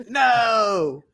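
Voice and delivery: long wailing shout of objection; mock protest. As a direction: shout it out in playful outrage. Protests being moved to the bottom.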